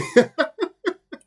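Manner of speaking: short bashful giggle up high; high embarrassed laugh. Real, not acted. Streamer overwhelmed by viewer's praise, giggles.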